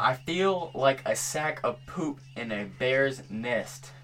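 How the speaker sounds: slow, carefully spaced words; slow and deliberate. Shown as a demonstration. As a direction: spell it out slowly for a lip reader. Sentence repeated for lip reader.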